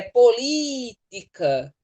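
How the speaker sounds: "política" with a lengthened LÍ; stressed syllable held long. Shown as a demonstration. Teacher proving why it takes an accent, "política" stretched on LÍ. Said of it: "a sílaba mais forte é a antepenúltima".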